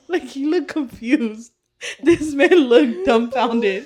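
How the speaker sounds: loud, drawn-out amused retelling; laughing. Real, not acted. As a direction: tell it through real laughter. Laughing while telling about confused partner.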